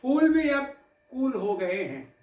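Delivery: measured, dramatic poetic recital with held pauses; poem recitation. Acted. Poet recites tribute to the audience, in recital cadence. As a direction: recite it like a poet at a memorial gathering.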